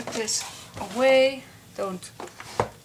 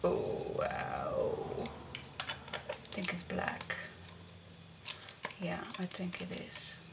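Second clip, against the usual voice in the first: whispering; 3 semitones lower, 12 dB quieter than usual. Real, not acted. Whispered to self.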